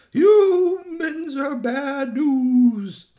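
very high, loud, drawn-out words; hooting owl mother. Acted. Owl mother warns her child about humans, slow high hooting.

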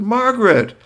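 high, smooth greeting; gracious Doctor. Acted. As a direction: charm the shopkeeper. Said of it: "calmly stepped up and said".

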